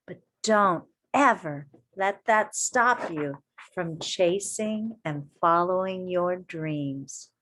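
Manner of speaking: ordinary delivery, nothing marked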